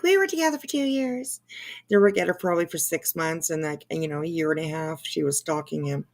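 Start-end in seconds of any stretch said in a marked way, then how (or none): 0.0-1.4 high-pitched mimicry; mocking Laura. Mimicking Laura's two-year claim to the stream, mocking. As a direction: parrot her boast in a high put-on voice. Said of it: "Laura's like".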